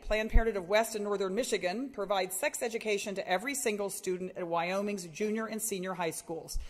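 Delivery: normal talking voice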